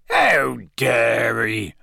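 slow, drawn-out growl; gruff, outraged character growl; Hagrid growling. A narrator's reading. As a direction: growl with indignation. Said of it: "Hagrid growled".

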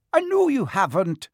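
a touch of admiring exasperation; half exasperated, half admiring. Acted. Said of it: "sounding half exasperated, half admiring".

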